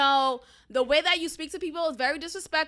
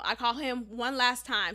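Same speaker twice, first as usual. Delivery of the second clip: normal talking voice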